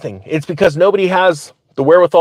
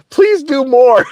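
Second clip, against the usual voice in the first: high, pleading mock voice; mock pleading engineer; 9 semitones higher, slower than usual. Firefighter mimics his laughing engineer, high mock plea. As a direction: ham up a squeaky plea for more. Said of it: "He's like".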